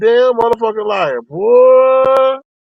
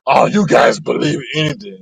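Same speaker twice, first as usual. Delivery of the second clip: low mock voice, dismissive; mocking quote; 9 semitones lower than usual. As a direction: brush them off with a sneer. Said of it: "Talking about".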